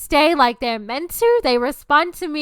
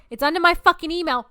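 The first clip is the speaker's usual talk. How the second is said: ordinary talk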